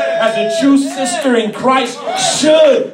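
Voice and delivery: loud, driving sermon delivery; impassioned church exhortation, projected loud; full-force preaching. Real, not acted. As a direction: preach it hard, punching each phrase loudly.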